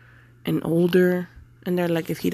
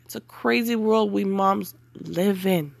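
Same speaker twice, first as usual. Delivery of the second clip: ordinary talk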